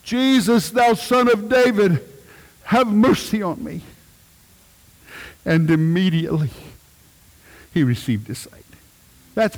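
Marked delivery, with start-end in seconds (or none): 0.0-3.8 calling out. Retold or imitated. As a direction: cry out to be heard over the crowd. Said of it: "Bartimaeus' prayer".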